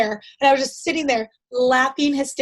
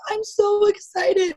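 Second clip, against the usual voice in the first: high, drawn-out mock-weepy insistence; imitated tearful excitement; 8 semitones higher, slower than usual. Crying shopper assures the member she is happy, mock tearful. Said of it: "I literally was crying".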